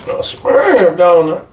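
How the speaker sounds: slow, stunned echo, the word sperm leaned on; outraged disbelief. Retold or imitated. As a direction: repeat it back in shock.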